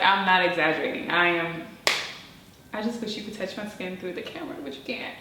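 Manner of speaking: usual speaking voice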